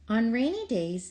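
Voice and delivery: ordinary delivery, nothing marked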